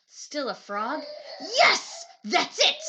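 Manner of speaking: sudden shout of joy; excited. Acted. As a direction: burst out with the idea. Prince's sudden idea.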